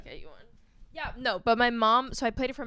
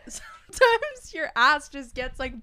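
laughing; 4 semitones higher, 6 dB louder than usual. Real, not acted. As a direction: talk through the giggles. Laughing while explaining.

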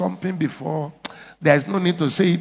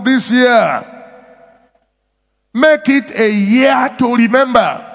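fervent preaching; 5 semitones higher, 4 dB louder than usual. Acted. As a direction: raise the voice and press each claim on "Make it". Pastor preaching with rising force.

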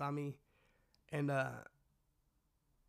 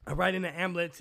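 ordinary delivery

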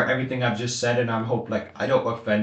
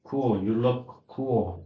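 slow awkward compliment; 3 dB quieter, much slower than usual. Retold or imitated. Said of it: "it's like".

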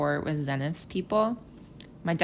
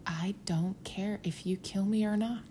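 hushed, slow whisper; whispered quote; 3 semitones higher, 7 dB quieter than usual. Retold or imitated. Imagining saying it to killers, whispered. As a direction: breathe it out in disbelief. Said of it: "can you imagine being like".

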